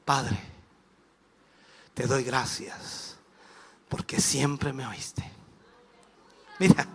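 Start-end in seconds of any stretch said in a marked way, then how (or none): 0.0-5.3 low, slow, soft prayer to the Father; hushed, measured thanksgiving; quiet prayer. Acted. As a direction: give thanks unhurriedly, voice dropped. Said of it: "te acuerdas lo que dice".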